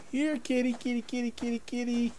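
high, soft coaxing kitty call. Real, not acted. Calling a hidden big cat toward him, high and coaxing. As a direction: coax the cat out in a sing-song.

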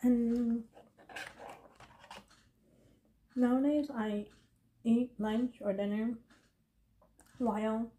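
chewing between words; talking while eating; mouth full. Real, not acted. Seen 1: Eats from food box with fork; lip-licking, closed-mouth chewing while talking.

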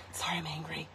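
very soft, whispered apology; muttered aside. Real, not acted. Host apologizing to viewers after a mishap, muttered aside. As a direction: drop it to a near-whisper under your breath.

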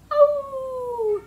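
high, drawn-out howl; wolf howl. Shown as a demonstration. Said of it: "wolves howling".

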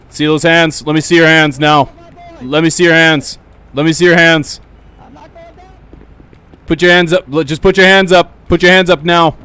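loud, stern police orders; shouted commands. Acted. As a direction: bark the commands at the suspect. Cop commanding suspect.

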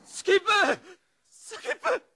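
high desperate cries of the name; anguished calls. Acted. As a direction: cry out for the dying friend. Friend shot, crying out name.

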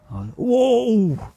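a loud, high whoa of surprise; surprised whoa. Real, not acted. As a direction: gasp out a real amazed whoa. Sees worms vanish at once.

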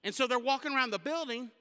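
ordinary talk

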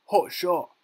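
high, loud cheer; admiring shout. A narrator's reading. Boy cheers his friend's hit, excited shout. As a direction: shout praise for the shot. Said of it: "shouted Raymond".

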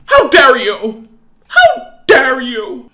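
loud, slow outrage; mother rounding on her son in fury; furious outburst. Acted. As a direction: spit out the outrage.

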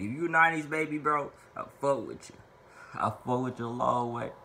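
ordinary talk

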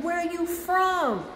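high, slow curious voice; curious crowd. Retold or imitated. Admirers asking where the dancer is from, high and slow. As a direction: ask it high and slow, intrigued. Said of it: "They be like".

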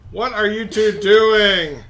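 loud, high, drawn-out question; exasperated shout. Real, not acted. Scolding the two players for their antics, exasperated shout. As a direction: call them out, stretching the words.

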